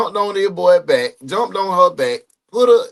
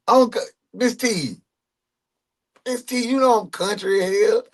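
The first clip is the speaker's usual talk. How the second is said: normal talking voice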